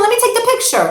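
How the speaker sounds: high, pleading parent voice; a fussing mom, still holding back. Retold or imitated. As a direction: beg the kid to wait for the photo. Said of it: "and you say to the kid".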